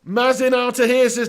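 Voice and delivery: normal talking voice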